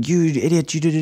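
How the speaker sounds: a hint of an attacker's scorn; mock aggressive. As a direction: snap at them in play. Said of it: "Instead of going".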